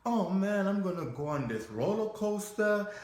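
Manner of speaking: put-on high, loud, thrilled kid; excited child. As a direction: whoop like a child heading for a roller coaster. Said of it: "now I feel like little child".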